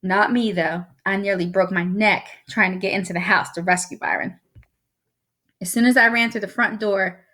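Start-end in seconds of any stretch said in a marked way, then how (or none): none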